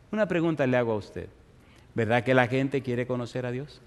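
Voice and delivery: usual speaking voice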